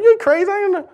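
high-pitched scoffing listener; mock disbelief. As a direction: imitate someone finding the idea crazy. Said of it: "some of you look at it".

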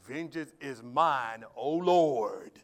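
slow loud mocking of how people twist the verse; mock vengeful prayer. Shown as a demonstration. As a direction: ham up a grudge-holding prayer. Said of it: "we twisted that scripture. We say it like this".

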